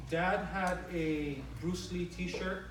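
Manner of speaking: normal talking voice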